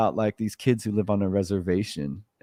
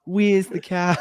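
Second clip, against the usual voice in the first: higher-pitched New Zealand accent take; mock Kiwi accent; 6 semitones higher, slower than usual. Shown as a demonstration. Contrasting Kiwis with Australians, higher mock New Zealand accent. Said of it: "we're more like".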